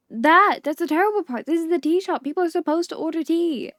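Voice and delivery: mock outrage about the tea shop; indignant outburst.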